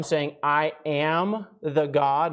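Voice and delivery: slow, leaning hard on "am"; stress on am. Shown as a demonstration. Preacher stresses present tense to the congregation, "am" drawn out. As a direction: stretch out "am" in "I am the God". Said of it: "not I was the God".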